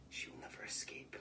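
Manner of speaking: hissed whisper, dark and certain; menacing whisper. Acted. The king swearing she will not escape, whispered. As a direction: breathe the threat.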